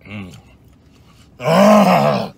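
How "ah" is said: powerful roar. Pretend. Power surge yell.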